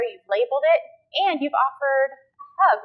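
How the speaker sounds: normal talking voice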